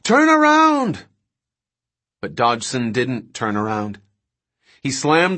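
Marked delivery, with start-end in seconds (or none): 0.0-1.1 long, high, loud scream; panicked scream. Acted. King begs the driver to flee the tyrannosaur, drawn-out screaming. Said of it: "King screamed".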